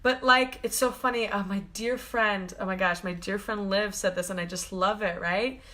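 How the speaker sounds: ordinary talk, nothing marked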